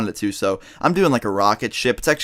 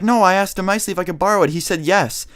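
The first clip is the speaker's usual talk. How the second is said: put-on higher-pitched, quick retort of a preschool girl; mock defensive denial; 7 semitones higher than usual. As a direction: imitate a child protesting innocence, higher. Classmate's retold denial to teachers.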